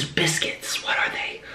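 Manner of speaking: breathy whisper to herself, slow; hushed, searching for the word; whisper to self. Real, not acted. Whispering while trying to recall.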